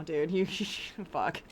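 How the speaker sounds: usual speaking voice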